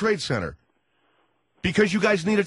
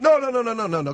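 rapid-fire protest, pitched up; impatient cutting in; 5 semitones higher than usual. Acted. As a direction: cut the caller off, exasperated. Host cuts off evasive caller.

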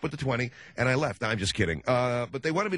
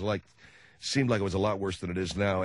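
ordinary delivery

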